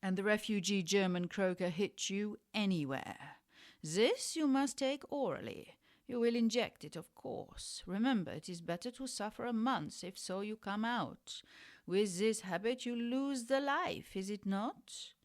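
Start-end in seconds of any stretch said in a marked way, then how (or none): 3.8-7.8 higher-pitched, hammed-up German accent; terrible German accent. Refugee German doctor instructing a junkie, bad German accent. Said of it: "That was a terrible German accent". 7.8-15.1 quick, badly faked German accent; terrible German accent. Refugee German doctor warning a junkie of the habit, bad German accent. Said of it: "That was a terrible German accent".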